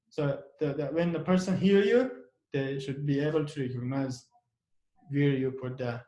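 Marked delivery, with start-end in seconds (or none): none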